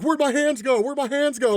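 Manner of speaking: high-pitched mock panic; silly high mimicry, poking fun; mocking victims. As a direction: squeal it like a ridiculed, bewildered victim. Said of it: "they're literally just making fun".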